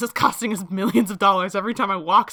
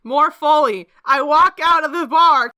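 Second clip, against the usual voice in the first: louder, much higher call; loud announcement; 7 semitones higher, 9 dB louder than usual. Pretend. [name] calling for more foley, loud and high. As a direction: belt it out for the sound crew.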